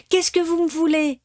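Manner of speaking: high, loud scared yell; frightened shout. A narrator's reading. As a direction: cry out in terror at the intruders. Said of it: "hurla Pinocchio, effrayé".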